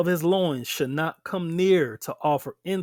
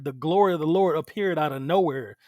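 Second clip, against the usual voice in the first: ordinary talk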